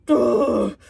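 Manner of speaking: loud, drawn-out "ugh" of disgust; exasperated groan. Real, not acted. Reviewer venting at a movie she hates, exasperated groan. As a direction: let out a loud fed-up groan. Fed up with the film.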